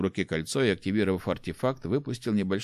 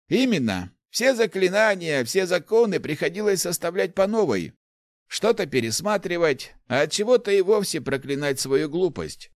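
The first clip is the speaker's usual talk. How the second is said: put-on higher-pitched, animated old man's voice; eager old inventor; 10 semitones higher, 4 dB louder than usual. Old inventor tells the rector of his path, animated. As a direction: proudly recount your life's work, pitched up.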